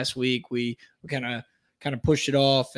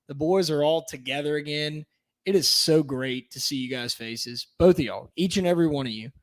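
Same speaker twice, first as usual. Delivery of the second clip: normal talking voice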